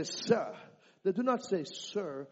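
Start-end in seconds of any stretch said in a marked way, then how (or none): 0.0-0.5 Nigerian sir. Shown as a demonstration. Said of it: "When they say". 1.6-2.3 American sir. Shown as a demonstration. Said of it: "like we say in America".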